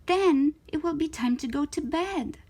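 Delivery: plain reading voice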